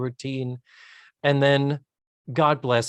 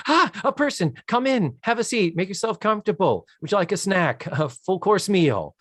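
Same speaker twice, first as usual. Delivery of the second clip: high, gushing welcome; eager hostess; 7 semitones higher than usual. Retold or imitated. Phyllis welcoming the court clerk at the door, eager hostess. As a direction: pounce on the visitor with excited hospitality.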